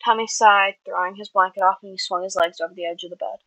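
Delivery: usual speaking voice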